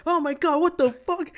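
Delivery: loud, high-pitched panicked yelp; scared outburst. Retold or imitated. Podcaster retelling a fur blob darting past, frightened yell. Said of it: "I'm, like, scared".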